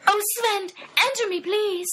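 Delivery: high, breathy pleading woman's voice; begging. A narrator's reading. Brenda begging Sven, high and pleading. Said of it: "begged Brenda".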